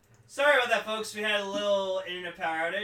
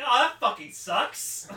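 mock outburst; 9 semitones higher, 9 dB louder, slower than usual. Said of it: "I'm just like".

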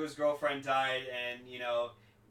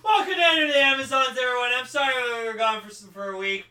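excited host; 9 semitones higher, 12 dB louder than usual. Pretend. Mock host welcome.

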